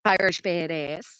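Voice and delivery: normal talking voice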